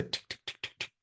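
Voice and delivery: soft unvoiced tick tick tick; hushed clock ticking; whispered ticking. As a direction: whisper it like a clock in your head. Brain ticking with worry.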